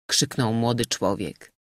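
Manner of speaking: ordinary delivery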